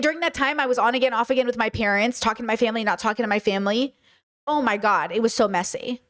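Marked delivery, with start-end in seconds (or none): none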